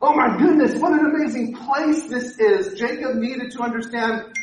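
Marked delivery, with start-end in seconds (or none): 0.0-2.8 amazed exclamation. Retold or imitated. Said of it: "Jacob wakes up going".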